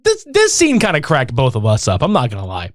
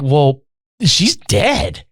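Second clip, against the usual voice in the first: slow solemn; 3 semitones lower, much slower than usual. Retold or imitated. Teacher quote.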